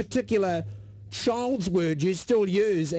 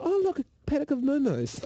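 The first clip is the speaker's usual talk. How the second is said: put-on higher, cooing talk to a child; baby talk; 6 semitones higher than usual. Parent pointing out cows to a toddler, mildly cooing. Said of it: "we're like".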